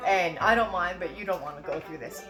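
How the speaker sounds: normal talking voice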